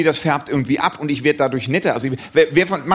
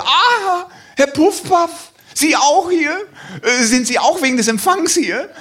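high, bright, eager man's voice; eager stranger; 11 semitones higher, slower than usual. Retold or imitated. Stranger at the hotel greeting the comedian, eager. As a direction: greet him like a fan. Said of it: "kam ein Mann auf mich zu und sagte".

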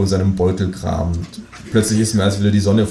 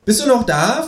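much higher cheeky kangaroo voice. Acted. Kangaroo complaining about the dark restaurant, high cartoon voice. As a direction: pitch it way up like a cartoon animal. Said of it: "fragt das Känguru".

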